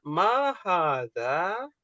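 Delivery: slow, drawn-out question; slow model question. Shown as a demonstration. Teacher drills the Arabic question slowly. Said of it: "Ketika Ana bertanya".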